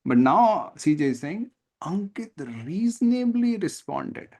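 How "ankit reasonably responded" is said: higher, slow, with the weight on reasonably; stress on reasonably. Shown as a demonstration. Tutor showing the author's added word, reasonably stressed. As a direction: lean on reasonably to show the author's judgement. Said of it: "C J is inserting his assessment".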